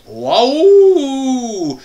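long, high, loud "waouh"; drawn-out whoop of wonder; amazed wow. Acted. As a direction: swoop up and hold it in delight. Thrilled at free buffet.

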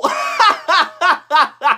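high cracking laughter; laughing fit. Real, not acted. Roasting friends, breaking into a cracking laugh. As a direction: laugh helplessly.

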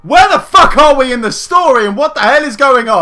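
loud, high-pitched outburst; yelled, fed-up demand for answers; exasperated shout. Real, not acted. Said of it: "Stop shouting".

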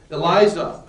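loud call, well short of a full scream; narrator's restrained screamed cry; mild scream. A narrator's reading. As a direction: call out the name loudly in alarm. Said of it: "I screamed".

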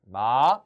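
마 with a rising glide. Shown as a demonstration. Teacher showing learners how, 마 climbing from low. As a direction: start 마 low and pull it up. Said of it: "이렇게".

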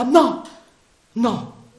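high, loud cry of refusal; exclaimed no. Acted. Marianna cries out to stop Sandokan, high loud exclamation. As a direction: cry out against his plan. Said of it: "esclamò ella".

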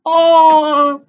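high, loud wail of dismay; disappointed cry. Acted. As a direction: moan as the game dies. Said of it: "Anita cried".

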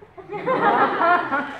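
big high laugh, laughing at himself; loud high laughter at his own story; loud burst of laughter. Real, not acted. Laughs about dodging grammarian.

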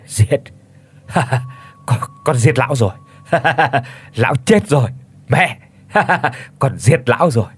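mad giggling between the words; crazed laughter. A narrator's reading. Boy who killed his father laughs madly to his mother. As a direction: babble it, laughing like he's lost his mind. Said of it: "cười hành hạch từng tràng man dại".

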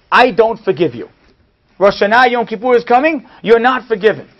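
emphatic, accusing; indignant confrontation. Retold or imitated. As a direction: declare it hard and loud, as a verdict. Retold angry confrontation with wealthy man.

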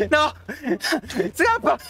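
high panicked scream; being chased. Real, not acted. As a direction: shout in terror.

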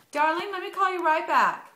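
affectionate phone aside. Real, not acted. Answering boyfriend's call mid-interview.

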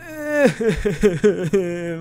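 wavering, stuttering high moan; mock dying wail. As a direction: let out a broken, hammed-up death cry. Pretend dying in the game.

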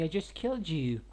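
ordinary talk

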